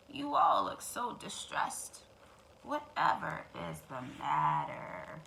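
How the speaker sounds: low, slow, sugary fox; sly fox concern. Acted. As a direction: fake sympathy, drawn out.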